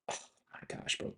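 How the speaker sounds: soft whisper of disbelief; muttered whisper; whispered dismay. Real, not acted. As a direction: breathe it out in dismay.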